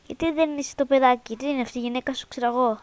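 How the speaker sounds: usual speaking voice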